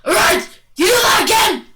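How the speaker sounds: high, loud, fast threat; Mario's angry yell. Acted. Mario warning the Goomba, hammed-up shout. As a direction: yell it as a worked-up cartoon hero. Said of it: "And Mario's like".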